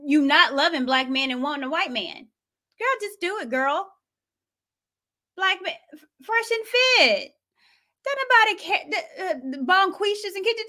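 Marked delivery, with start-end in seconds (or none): 2.8-3.9 put-on high sassy girlfriend voice; mock sassy advice. Host mocking a woman's advice in a high sassy voice. 5.4-10.7 put-on high sassy girlfriend voice; mock sassy advice. Host mocking a woman's advice in a high sassy voice.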